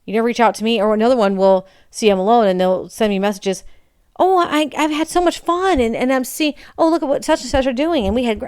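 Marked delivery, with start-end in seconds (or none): 4.2-8.5 high gushing parody of a happy friend; mock bubbly friend. Mocking a friend who brags about her fun, bubbly and high. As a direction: gush like someone showing off their fun.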